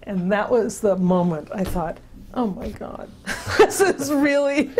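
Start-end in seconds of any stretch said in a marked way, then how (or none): 2.3-4.8 laughing realization. Real, not acted. Realizing they lack basic concepts.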